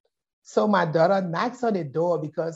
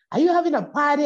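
husband quoted; 9 semitones higher, 5 dB louder, much faster than usual. Retold or imitated. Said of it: "my husband said".

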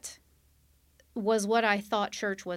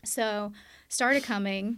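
normal talking voice

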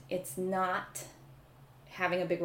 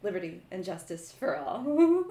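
a hint of a solemn oath; lightly mock solemn pledge; solemn will reading; 4 semitones higher, 6 dB louder than usual. Shown as a demonstration. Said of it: "share your sound check".

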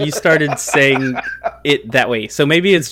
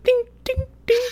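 ding counter. Shown as a demonstration. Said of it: "count, just like".

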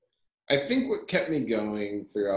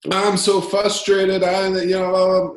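frustrated customer; 9 semitones higher, 6 dB louder than usual. Retold or imitated. Caller phoning the founder, voiced as a high loud whiny complainer. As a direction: whine it like an upset caller.

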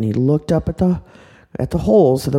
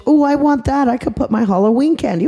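put-on eager little girl; 6 semitones higher than usual. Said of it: "She goes".

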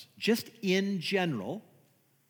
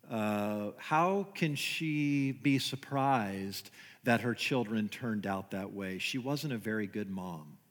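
hushed, lower, softer gossipy tone; judgmental churchgoer; 7 semitones lower, 6 dB quieter than usual. Retold or imitated. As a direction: mutter it like a self-righteous believer passing judgment. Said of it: "This is how we think".

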